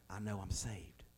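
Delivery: breathy retold whisper; hushed whisper. Pastor retelling dying friend's words, whispered. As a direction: whisper it softly, a dying man's calm certainty.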